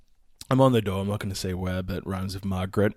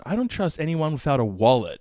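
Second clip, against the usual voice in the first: ordinary talk, nothing marked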